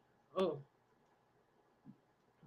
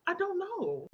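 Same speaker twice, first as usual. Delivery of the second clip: high-pitched, helpless protest; exasperated retort; 11 semitones higher, slower than usual. Retold or imitated. Answering sisters who expect a translation, exasperated. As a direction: throw it up high, mock exasperation.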